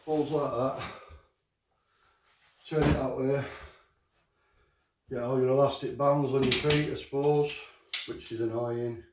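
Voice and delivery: normal talking voice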